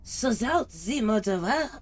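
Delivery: loud, high hammed-up French detective; exaggerated comic French accent; mock French accent. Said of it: "I wasn't expecting all the accents".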